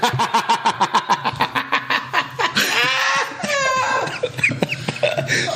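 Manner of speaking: long high cackle of disbelief; laughing at the refusal. Real, not acted.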